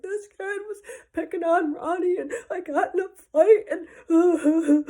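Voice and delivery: loud, high-pitched sobbing wail; mock crying child. Child explains the fight to dad, mock sobbing. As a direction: blubber it out like a hammed-up crying kid. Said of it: "We're crying the whole way".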